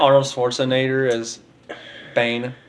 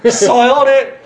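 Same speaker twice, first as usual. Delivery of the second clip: higher, louder mocking echo; 10 semitones higher, 6 dB louder, slower than usual. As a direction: throw the word back at him. Mocking a friend's word.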